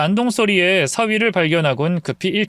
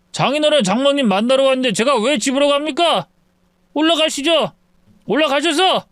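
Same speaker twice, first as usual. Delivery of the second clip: put-on a drunk man hollering at his in-laws' door; drunk son-in-law; 7 semitones higher than usual. (술 취한 사위의 높은 외침)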